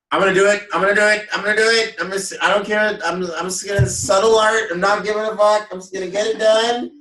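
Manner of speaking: high, loud rush of resolve; psyching self up. Retold or imitated. Hyping self up to commit.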